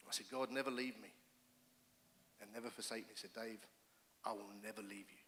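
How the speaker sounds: hushed, tender reply from God; God reassuring. Pretend. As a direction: reassure him quietly as God. God answers the frightened man.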